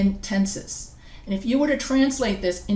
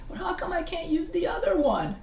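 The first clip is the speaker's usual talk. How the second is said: lightly mimicked student, higher and softer; higher, quieter imitation of a student asking; questioning student; 7 semitones higher, 5 dB quieter than usual. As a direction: put a puzzled question in a student's mouth.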